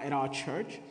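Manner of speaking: ordinary talk, nothing marked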